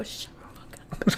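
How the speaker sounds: hissed whisper, voice off. Retold or imitated. Whispering at a friend to keep the secret quiet. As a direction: whisper it so the teacher can't hear.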